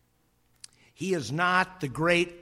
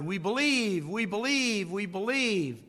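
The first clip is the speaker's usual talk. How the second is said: lightly voiced crowd chanting higher; mock crowd shout; 6 semitones higher than usual. Crowd at Niagara answering Blondin, mock shout. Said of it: "the people shouted".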